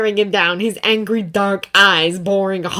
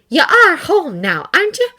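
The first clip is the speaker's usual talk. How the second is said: hopeful plea; 9 semitones higher, 5 dB louder than usual. A narrator's reading. Sharon pleading hopefully.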